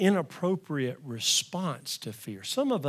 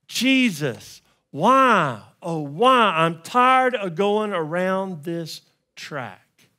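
loud, high anguished cry to Jesus; desperate scream; 5 semitones higher, 10 dB louder than usual. Retold or imitated. Believer screaming at sleeping Jesus, loud anguished cry. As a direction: cry out at the top of your lungs in despair. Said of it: "We're screaming at the top of our lungs".

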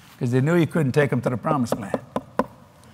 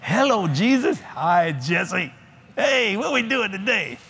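higher, playful voice greeting Jesus like a friend; cheerful talk with Jesus; 4 semitones higher, slower than usual. Acted. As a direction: chat with Jesus like an old buddy. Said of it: "start getting into fellowship".

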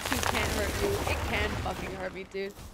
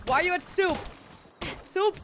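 mock outrage; 7 semitones higher, 4 dB louder than usual. Mock outrage at being at soup.

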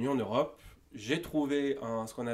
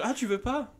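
pushy salesman; 11 semitones higher than usual. Retold or imitated. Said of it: "un commercial type B R P".

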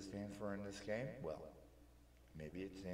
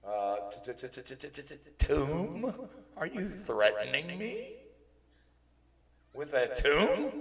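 high, loud, stammering alarm; hammed-up frightened yelp; panicked stutter; 7 semitones higher, 13 dB louder than usual. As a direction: stutter rapidly, then shriek the questions. Neptune alarmed by the word tome.

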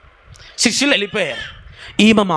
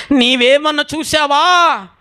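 high, drawn-out cry; loud anguished plea; 9 semitones higher, 5 dB louder, slower than usual. Acted. Grieving Mary asks if he has seen Jesus, crying out. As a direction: cry the question out high and long. ఈ తల్లి అంటుంది.

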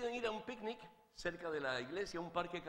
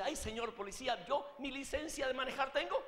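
higher, hammed-up flustered husband; comic nervous confession to a policeman; mock nervous; 6 semitones higher than usual. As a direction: blurt it out like a nervous driver, rising at the end. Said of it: "se pone tan nervioso que dice".

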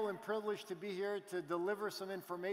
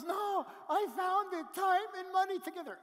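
imitating a giddy friend; 13 semitones higher, 8 dB louder than usual. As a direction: stretch it out, savoring the news. Friend retold, slow and excited.

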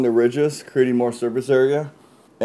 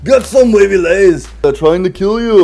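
Terminator voice; 6 semitones higher, 9 dB louder than usual. Acted. Terminator cooking parody.

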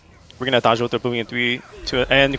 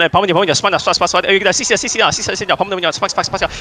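urgent high-pitched calls; excited shouting callouts; 6 semitones higher, 4 dB louder than usual. Real, not acted. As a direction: yell the calls to the team fast. Urgent shotcalling during team fight.

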